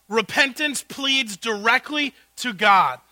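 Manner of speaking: ordinary talk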